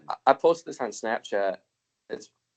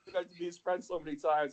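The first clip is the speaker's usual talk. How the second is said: ordinary talk, nothing marked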